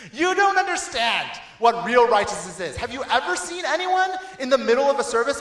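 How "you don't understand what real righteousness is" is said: ordinary delivery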